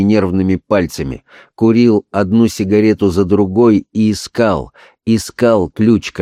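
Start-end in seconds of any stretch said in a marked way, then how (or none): none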